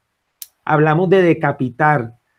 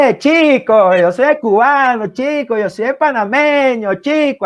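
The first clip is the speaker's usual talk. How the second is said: high-pitched sing-song caricature of a Caribbean man; hammed-up movie Cuban, pitched way up; 8 semitones higher than usual. Writer parodying how films ridicule Caribbean people, mocking caricature. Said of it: "desde la perspectiva caribeña".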